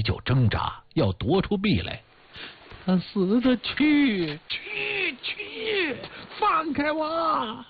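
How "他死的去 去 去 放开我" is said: frantic, high-pitched strained cry; drawn-out cries of a crazed widow struggling free; hoarse cry. A narrator's reading. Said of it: "他哑着嗓子喊".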